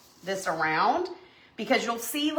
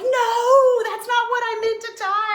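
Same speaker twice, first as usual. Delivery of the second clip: high, loud, dismayed protest; mock horrified; 13 semitones higher, 7 dB louder than usual. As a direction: yelp it in mock alarm. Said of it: "You're like".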